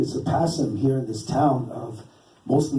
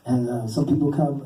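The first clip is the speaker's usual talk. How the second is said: ordinary talk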